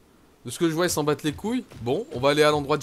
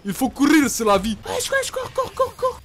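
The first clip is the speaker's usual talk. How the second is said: high loud frantic shouts to run; urging himself to flee; real panicked cries, rising to shrieks; panicked yelling; 9 semitones higher, 4 dB louder, slower than usual. Real, not acted. Fleeing monsters in game.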